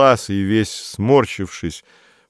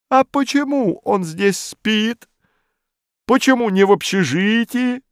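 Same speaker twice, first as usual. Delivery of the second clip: outraged officialdom; indignant bureaucrat. A narrator's reading. Modest indignant.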